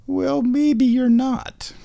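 high, slow sing-song for the cat; slow pet talk. Real, not acted. Owner teases her cat in a high, slow sing-song. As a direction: stretch it out, high and teasing, to the kitty.

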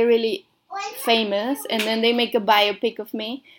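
ordinary talk, nothing marked

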